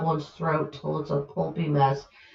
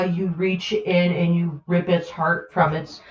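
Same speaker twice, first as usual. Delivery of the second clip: ordinary delivery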